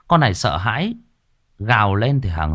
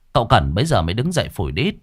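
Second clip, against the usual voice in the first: ordinary delivery, nothing marked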